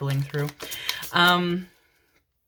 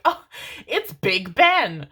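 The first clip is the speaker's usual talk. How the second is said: loud, drawn-out exclamation; loud mock-revelation; excited realisation; 4 semitones higher, 6 dB louder, much slower than usual. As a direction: act out a sudden discovery, stretching the words. Acts out partner's excited realisation.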